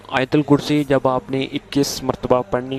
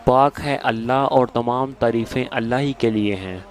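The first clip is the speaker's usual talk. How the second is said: ordinary delivery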